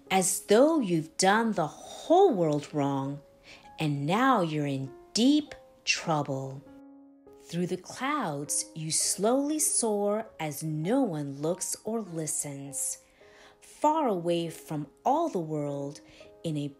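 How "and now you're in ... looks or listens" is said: ordinary delivery, nothing marked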